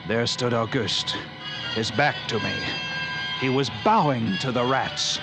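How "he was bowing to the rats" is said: usual speaking voice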